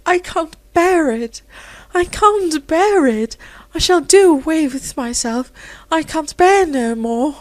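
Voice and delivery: high, tearful, breaking with despair; despairing sob. Acted. Weeping girl begging Rosemary for help, tearful.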